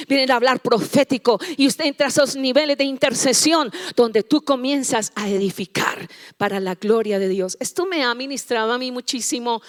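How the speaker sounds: normal talking voice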